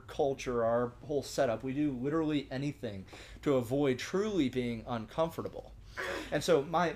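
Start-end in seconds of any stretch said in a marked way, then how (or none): none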